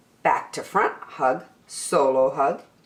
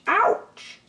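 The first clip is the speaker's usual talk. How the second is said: ouch. Acted. Splinters hurt Douglas.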